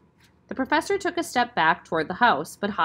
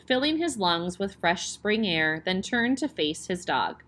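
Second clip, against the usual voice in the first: plain reading voice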